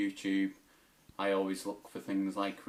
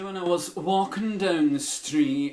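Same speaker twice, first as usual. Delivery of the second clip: higher, louder camp Scottish storyteller; Billy Connolly done camp; 8 semitones higher, 8 dB louder than usual. Retold or imitated. Impressions video, a camp higher Scottish anecdote. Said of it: "my Billy Connolly turned out to be quite camp".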